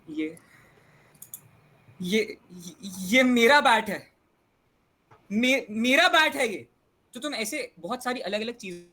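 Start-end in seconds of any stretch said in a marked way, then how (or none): 0.1-4.1 loud, slow and high; emphatic claim. Shown as a demonstration. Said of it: "या तुम ऐसे बोलो". 5.3-6.7 loud, high, stretched-out claim; changed tone. Shown as a demonstration. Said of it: "या तुम ऐसे बोलो".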